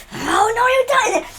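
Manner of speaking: very high, alarmed wail; panicked Kitten. Acted. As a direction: squeal it in dismay. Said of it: "He's just like".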